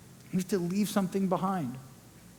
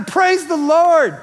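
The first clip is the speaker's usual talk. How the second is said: joyful exclamation; 8 semitones higher, 11 dB louder, slower than usual. Acted. Delighted at a new crib.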